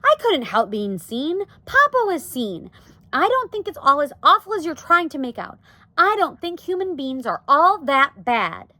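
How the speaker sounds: high, loud, defensive Arrietty; a touch of upset protest; crying out. A narrator's reading. Daughter argues humans aren't all bad, crying out. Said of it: "cried Arrietty".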